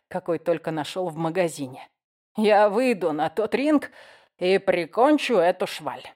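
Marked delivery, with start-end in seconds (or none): 2.4-6.0 put-on slow, heavy drunk menace; drunk threat. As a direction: slur the threat slowly.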